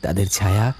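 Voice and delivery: ordinary delivery, nothing marked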